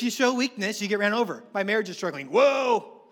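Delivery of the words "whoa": loud, high startled whoa; hammed-up shocked reaction; mock alarm. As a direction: recoil as if scandalized. Mimics alarmed church reaction.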